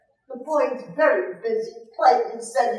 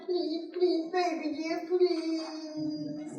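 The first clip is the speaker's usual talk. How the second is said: higher, softer little plea from the son; mock pleading son; 6 semitones higher, 6 dB quieter, much slower than usual. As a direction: beg mommy sweetly, a touch hammed-up. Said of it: "call your mommy and".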